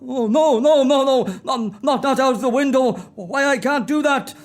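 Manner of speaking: high, pleading no, no, no; panicked refusal. Acted. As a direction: beg not to go out the window. Scrooge refuses to fly out.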